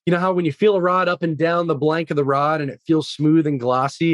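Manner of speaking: ordinary delivery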